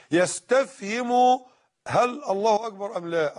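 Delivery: ordinary delivery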